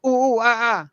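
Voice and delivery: high hooting bark, ooh ooh ah ah; hooting giggly monkey. Retold or imitated. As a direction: hoot it out like a silly dog. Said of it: "they used to always go".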